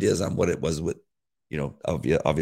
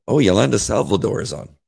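ordinary delivery

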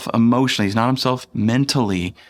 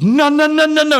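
very high, loud imitation of a frantic child; panicked little boy; 5 dB louder than usual. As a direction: squeal it like a small boy in mock panic. Frightened son silencing dad, retold.

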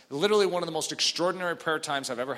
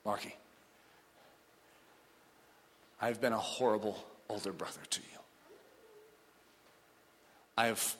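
quiet confession; 4 semitones lower, 5 dB quieter than usual. Retold or imitated. Brother's tearful confession retold.

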